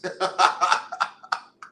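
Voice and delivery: bursting out laughing; big unvoiced laugh; loud laugh. Real, not acted. Laughing at caller.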